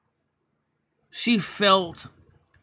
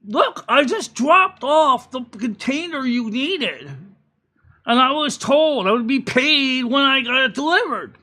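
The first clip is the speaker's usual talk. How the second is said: mock indignant trucker; 6 semitones higher, 5 dB louder than usual. Trucker arguing, acted.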